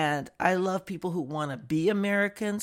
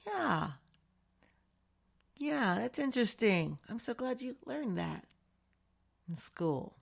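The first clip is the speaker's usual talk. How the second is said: polite, fake-interested yeah; mock polite agreement; 5 semitones higher, 5 dB quieter than usual. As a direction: fake interested, sweet higher voice. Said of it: "kinda go".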